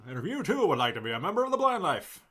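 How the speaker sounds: high, loud announcer pitch; infomercial voice. Acted. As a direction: sell it like a TV ad. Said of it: "You sound like an infomercial".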